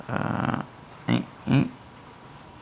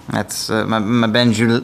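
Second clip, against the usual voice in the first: ordinary talk, nothing marked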